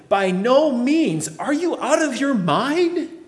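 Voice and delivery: high, loud, slow exclamation; Paul almost shouting at critics of grace, high and loud. Acted. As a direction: explode with disbelief. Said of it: "He begins by exclaiming, almost shouting".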